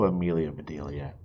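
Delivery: ordinary delivery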